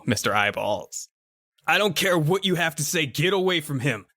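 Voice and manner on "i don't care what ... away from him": high-pitched, insistent order; confrontational. Acted. Neighbor warning the monster off the kid, agitated. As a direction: cut off the excuse, pitched up in alarm.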